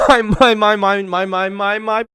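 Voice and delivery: laughing stammer. Acted. Laughing mock fan.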